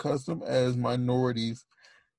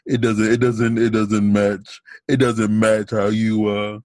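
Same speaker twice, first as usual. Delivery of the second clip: low, even-pitched chest voice, pushed louder; natural deeper voice; 5 semitones lower, 4 dB louder than usual. Shown as a demonstration. Showing a friend how people react to their natural voice, deeper voice. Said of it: "they hear my natural voice".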